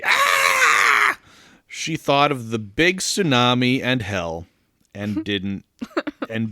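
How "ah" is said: put-on very high, long childish scream; hammed-up piercing wail; mock wail. A narrator's reading. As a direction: shriek like a bawling little girl. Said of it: "was tempted to say".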